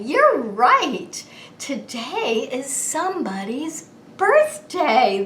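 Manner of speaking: put-on bright sing-song kids show voice; overbright kids party host.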